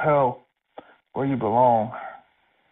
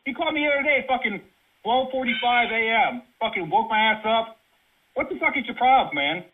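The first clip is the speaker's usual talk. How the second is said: angry yelling; 8 semitones higher than usual. Real, not acted. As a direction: yell it out in fury. Furious rant about late call.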